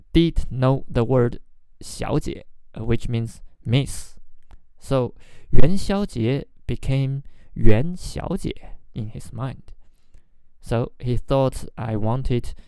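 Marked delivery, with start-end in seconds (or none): none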